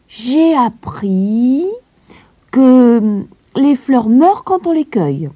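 put-on high, slow, dreamy child voice; thoughtful little girl. A narrator's reading. Heidi telling the governess what she learned, slow and high. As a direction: recall the mountains slowly and fondly. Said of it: "Heidi réfléchit avant de répondre".

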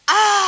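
high, loud despairing sigh. Acted. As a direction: sigh out heavily. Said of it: "poussa un long soupir".

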